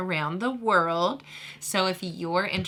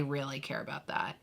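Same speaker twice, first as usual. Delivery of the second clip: whispered sincerity; 3 semitones lower, 11 dB quieter than usual. Real, not acted. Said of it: "whispered repeat of really".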